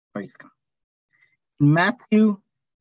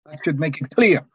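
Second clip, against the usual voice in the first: normal talking voice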